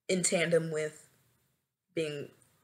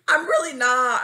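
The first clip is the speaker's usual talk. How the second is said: loud, higher emphatic denial; emphatic insistence; 7 semitones higher, 12 dB louder than usual. Real, not acted. Confessing they aren't used to rejection, emphatic insistence. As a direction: hit it hard and high. Insists, then repeats softer.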